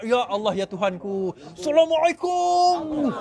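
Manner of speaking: mock-pious greeting, high and drawn out; angelic candidate. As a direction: stretch it out like a saintly candidate. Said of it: "Macam malaikat, ada yang melompat tu". (lagak malaikat, meleret-leret)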